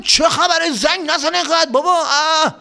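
high, loud irritated grumble at the doorbell; a cranky father yelling at the door bell; high-pitched annoyed dad calling out; Mohammad's grumpy dad. Acted. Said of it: "این صدای بابای محمد بود".

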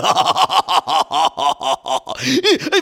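menacing evil laugh; evil cackle. Acted. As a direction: cackle like a dragon. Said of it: "مثل یه اژدها".

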